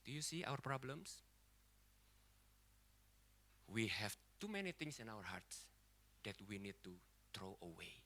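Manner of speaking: low, soft, solemn appeal; hushed preaching. Real, not acted. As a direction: let each phrase sink in with long pauses. Hushed earnest appeal to congregation.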